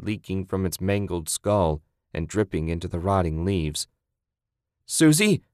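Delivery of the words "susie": high, loud cry of her name; desperate scream. Acted. Man calling to his partner after the attack, screaming. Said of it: "Ted screamed".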